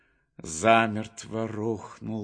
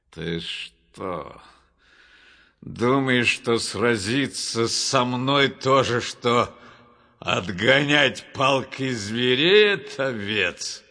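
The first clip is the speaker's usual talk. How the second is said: loud, scornful growl from a giant; growled, booming taunt; Goliath's growl; 7 dB louder, slower than usual. A narrator's reading. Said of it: "прорычал Голиаф".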